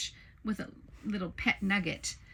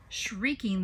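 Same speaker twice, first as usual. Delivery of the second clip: plain reading voice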